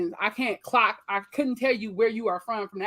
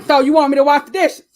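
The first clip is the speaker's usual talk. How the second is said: loud, raised-pitch check back; retold baffled guess; 5 semitones higher, 12 dB louder than usual. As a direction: check loudly what he could possibly mean. Acts out guessing at cousin's meaning.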